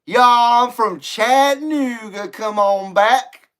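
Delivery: mock local Southern twang, slow at the end; Southern accent. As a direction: pander with a hint of Chattanooga drawl. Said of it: "get my Chattanooga voice".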